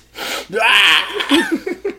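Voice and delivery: high, wailing scream after a hit; mock scream. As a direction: burst into a comic coked-up scream. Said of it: "Why is he screaming".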